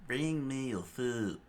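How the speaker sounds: slow, lightly voiced Dale drawl. Krieg demanding food, slow lightly done Dale voice. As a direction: try out a Dale impression. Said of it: "Dale from King of the Hill".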